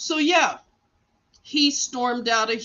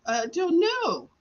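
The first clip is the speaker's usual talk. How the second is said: high-pitched mimicked helplessness; imitated nurse; 8 semitones higher than usual. Nurse asked for the doctor's email, high mock cluelessness. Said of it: "And she was like".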